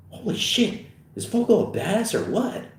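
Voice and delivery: hushed high start, then rising amazement; impressed high-pitched question; awed partygoer. Acted. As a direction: marvel in disbelief.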